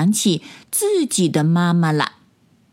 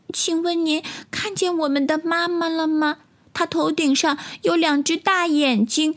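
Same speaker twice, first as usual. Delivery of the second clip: put-on high, childlike, softened questioning; small-voiced tadpoles; 7 semitones higher than usual. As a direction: ask timidly in a tiny high voice. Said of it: "小声地问". (小蝌蚪稚嫩地小声询问)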